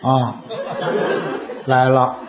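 low, hushed murmur; quiet voice. Acted. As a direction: keep it down to a hush. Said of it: "小声啊".

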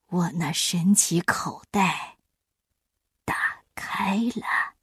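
slow hushed storyteller opening; slow story opening. A narrator's reading. Opening magic pouch ritual. (缓慢轻柔的开场)

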